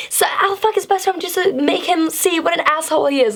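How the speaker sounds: high-pitched, fired-up rant at normal volume; retold angry yelling. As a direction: act out ranting to your girlfriends, pitch way up. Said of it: "I was so mad".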